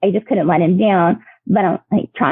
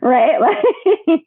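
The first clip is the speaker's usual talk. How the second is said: ordinary delivery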